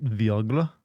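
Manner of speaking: "Viagra" drawn out as vee-AH-gla, rising; foreign-style pronunciation. Shown as a demonstration. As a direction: query "Viagra" slowly, starting with vee. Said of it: "Careful how you say that last one".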